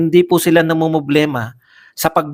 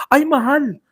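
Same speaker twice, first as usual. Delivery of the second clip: mocking gasp; 8 semitones higher than usual. Mocking a questioner's remark.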